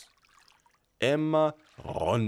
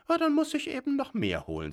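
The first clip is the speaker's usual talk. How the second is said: high, fast imp chatter; hurried little devil; 3 dB louder, faster than usual. A narrator's reading. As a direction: gabble it like a busy imp. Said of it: "sagte das Teufelchen".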